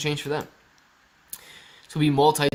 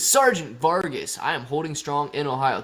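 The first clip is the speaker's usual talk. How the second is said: ordinary talk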